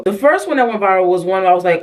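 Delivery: ordinary talk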